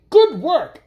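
high, loud praise; count's cheer. A narrator's reading. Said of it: "added the Count of Connotation".

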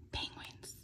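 breathless whisper of delight; excited whisper. Real, not acted. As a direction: whisper it like a happy secret. Reveals penguins in box.

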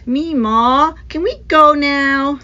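put-on loud whiny child's plea; whining. A narrator's reading. Bored boy whining to his grandmother, loud whine. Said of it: "D C whined".

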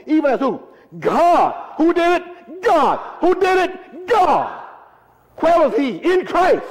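high, ringing call-and-response; full-force preaching. Real, not acted. Preacher asking the congregation who did it, full-force preaching.

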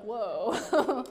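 drawn-out awestruck whoa, played for laughs; mock amazement. Disciples left in the boat after the storm stops, mock-amazed. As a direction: imitate dumbfounded onlookers.

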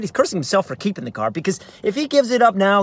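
ordinary delivery, nothing marked

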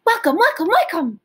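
quick high loud welcomes; as fast as we can. Shown as a demonstration. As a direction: rattle them off fast and loud. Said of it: "fast as we can".

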